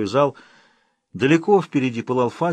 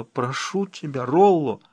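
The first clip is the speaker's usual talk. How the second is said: moaning plea; 3 semitones higher, slower than usual. Acted. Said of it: "Она с трудом простонала".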